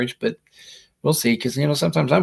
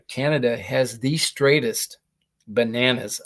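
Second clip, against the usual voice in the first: ordinary talk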